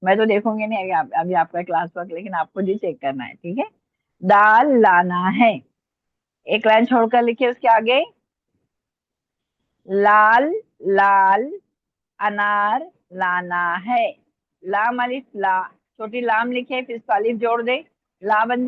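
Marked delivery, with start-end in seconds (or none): 4.2-5.5 slow, loud, measured words; slow dictation. Real, not acted. Teacher repeating the sentence to the class, slow dictation. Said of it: "मैं आराम आराम से बोल रही हूँ". 9.9-14.1 very stretched vowels, word by word; slow dictation. Real, not acted. Teacher dictating the next line to students, slow dictation. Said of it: "मैं आराम आराम से बोल रही हूँ".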